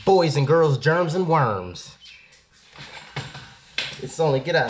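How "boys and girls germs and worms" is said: louder, higher showman's call; playful introduction to the crowd, slowing at the end; mock announcer. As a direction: announce it like a ringmaster, drawing out the end. Mock announcer addressing the audience.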